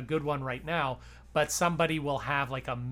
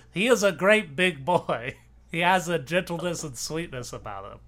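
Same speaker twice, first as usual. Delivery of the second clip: louder, gently amused Wilson impression; Woodrow Wilson voice; 6 dB louder than usual. Host repeats the quote in his Wilson voice, louder. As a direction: gush about him the way Wilson would. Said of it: "this would be my Woodrow Wilson voice".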